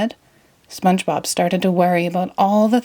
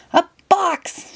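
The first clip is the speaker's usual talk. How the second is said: much higher, thrilled cry; excited shout; 12 semitones higher than usual. Acted. SpongeBob thrilled by the mailman's box, high excited cry. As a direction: squeal it with joy. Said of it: "shouted SpongeBob".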